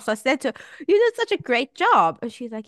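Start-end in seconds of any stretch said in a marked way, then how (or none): 0.9-2.2 high praise. Retold or imitated. Praising her mother.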